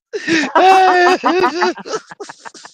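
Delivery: high squealing laugh. Real, not acted. Trucker cracking up at his own quote, high laugh.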